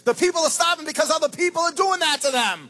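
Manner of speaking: fiery sermon climax; preaching at full force. Acted. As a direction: build to a shout against the greedy. Preacher building to a shout.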